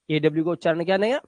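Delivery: usual speaking voice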